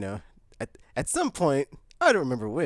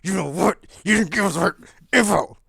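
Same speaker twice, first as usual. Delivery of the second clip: mock angry; 6 semitones higher, 8 dB louder than usual. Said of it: "He's like".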